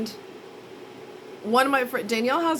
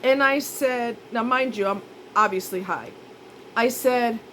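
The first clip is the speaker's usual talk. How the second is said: ordinary delivery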